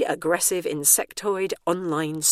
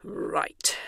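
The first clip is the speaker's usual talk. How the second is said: growled; 4 dB quieter than usual. A narrator's reading. Bernice resolves to leave, growling quietly. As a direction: growl it under the breath. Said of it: "she growled".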